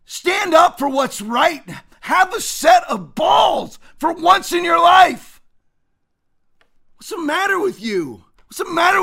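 furious real shout; angry shout. Real, not acted. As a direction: yell a challenge at them. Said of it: "Sorry for getting all fired up".

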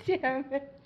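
fighting back laughter while talking; stifled giggles. Real, not acted. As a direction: giggles breaking through the words. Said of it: "trying not to laugh really hard".

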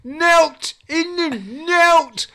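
put-on high, loud drunken Midlands accent rant. Glenn ranting at the journalist about money, Midlands accent. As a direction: rant in a broad Midlands accent, pointing at your pockets. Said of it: "Midlands accent".

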